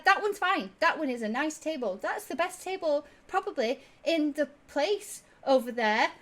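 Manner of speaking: very high, loud, sing-song shooing; bright but firm, hurry them off; insistent tabaxi. Acted. Tabaxi ordering patrons away from the table, very high and loud.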